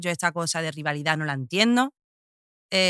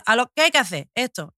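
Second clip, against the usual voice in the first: mock practical man; 6 semitones higher, 5 dB louder than usual. Mimics practical men getting on with it.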